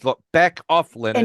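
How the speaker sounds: retold snap to make him back off; mock irritation. Penny tells Leonard to stop, irritated.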